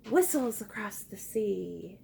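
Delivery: hushed, airy whisper; softly whispered. Shown as a demonstration. Teacher whispering the wind's phrase to the class. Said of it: "Say it softly with me".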